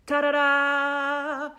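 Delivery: slow, high, loud ta-da; grand reveal. Real, not acted. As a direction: drag out a showy fanfare for the couch. Unveiling the pink couch.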